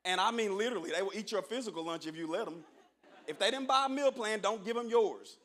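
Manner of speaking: usual speaking voice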